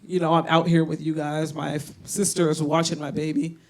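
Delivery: normal talking voice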